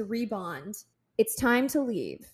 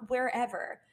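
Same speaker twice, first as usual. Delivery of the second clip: ordinary talk, nothing marked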